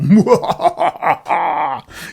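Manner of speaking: loud booming evil cackle; evil ghost laugh. Acted. The evil ghost appears to the heroes, loud evil cackle. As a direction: cackle like a spooky ghost rising up. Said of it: "the evil, uh, ghost come out and go".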